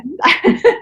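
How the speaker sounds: a short laugh rising high. Real, not acted. Laughing at mother's huff.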